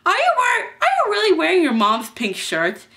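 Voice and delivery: high, loud teasing question; mocking disbelief. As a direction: ask it aghast and mocking. Kid mocks the pink shirt.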